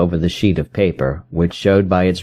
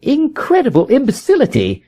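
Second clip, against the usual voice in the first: a scornful cry, far higher; indignant outburst. A narrator's reading. Holmes scorns the inspector's judgement, high indignant cry. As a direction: spit out contempt for the police. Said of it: "he cried".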